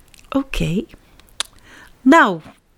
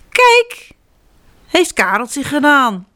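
put-on much higher, louder child boast; proud little elephant; 6 semitones higher, 4 dB louder, slower than usual. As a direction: announce it proudly. (trots olifantje, hoog)

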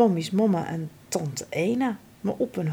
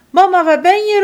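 high, loud frightened cry for mother; scared calling; 11 semitones higher, 10 dB louder, faster than usual. Acted. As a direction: call out lost and afraid. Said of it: "roept hij bang".

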